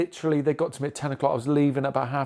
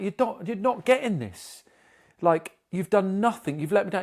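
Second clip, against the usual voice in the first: raised, heated retelling of his own complaint; pitched up, letting the anger show in the quote; angry retort; 6 semitones higher than usual. Retold or imitated. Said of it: "and was getting really angry".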